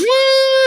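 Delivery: very high hammed-up bawl; mock crying wail. Teased kid running inside, high fake wail.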